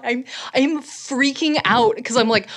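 ordinary delivery

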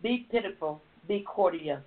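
ordinary talk

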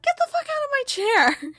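high, hammed-up outraged boss; mock angry boss. Boss ordering a star out of her chair, high and mock furious.

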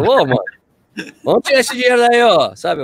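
high, loud teasing call to a runner; joking shout. Retold or imitated. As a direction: playfully call out to him.

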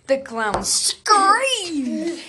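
"screamed" stretched very long and high; long, rising swell on "screamed"; stress on screamed. Shown as a demonstration. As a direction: draw out "screamed" for storytelling drama. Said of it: "when I mean scream".

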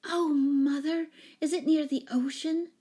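high, loud, eager outburst; excited cry. A narrator's reading. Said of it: "cried Jane".

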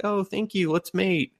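high-pitched mock-sweet voice; grateful girl cat. Girl cat thanks her rescuer, high sweet mock voice. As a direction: go high and girlish. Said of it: "And she'll be like".

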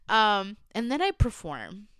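ordinary talk, nothing marked

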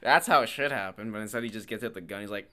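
ordinary talk, nothing marked

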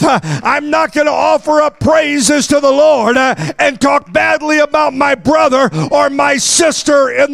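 high, shouted preaching; fiery preaching. Acted. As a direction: preach it hard to the congregation. Preaching at full force.

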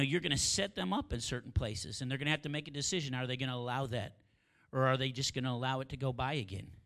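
rising, fervent pastor's prayer; preaching prayer. Acted. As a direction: pray it out over the congregation with building force. Leads the closing prayer.

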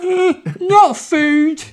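put-on very high, slow boyish squeak; drawn-out squeaky kid; high Luke voice. Luke voice continued.

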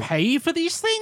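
very high, drawn-out incredulous question; mock indignation. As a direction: squeal it slowly in hammed-up disbelief. Mimicking sheikh's refusal to pay.

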